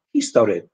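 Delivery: "history" with a tense Polish i and a full o; "history" as "heestory"; Polish vowel. Shown as a demonstration. Teacher showing the Polish mistake in "history". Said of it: "they say".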